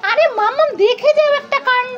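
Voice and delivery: put-on high, eager kid voice; bursting to show mum something; excited child. Child urging mummy to come see, high and excited.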